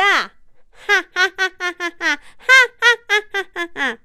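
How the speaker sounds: slow high maniacal cackle; evil villain laugh. Acted. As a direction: laugh long and menacing. (慢悠悠的狂笑)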